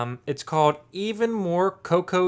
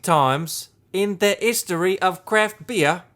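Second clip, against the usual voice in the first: put-on British accent; 2 semitones higher than usual. Host reads his beer can, put-on British accent. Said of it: "with a British accent".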